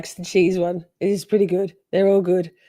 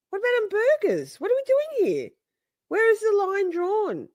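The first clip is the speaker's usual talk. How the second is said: mock disbelief; 12 semitones higher than usual. Real, not acted. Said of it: "mock outrage over cheese".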